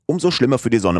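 proud defiance. Acted. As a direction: stand up for the watch against the sun.

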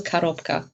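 Korobka with unstressed first O as A, "ka-ROP-ka"; Russian pronunciation. Shown as a demonstration. Blogger correcting the host's Russian, Korobka pronounced with an A first. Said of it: "ein unbetontes O wird wie ein A gesprochen".

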